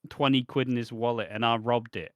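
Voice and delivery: ordinary talk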